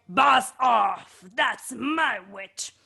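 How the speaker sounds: shouted monster warning. Acted. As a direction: bellow to chase the dragon off. The monster scares the dragon.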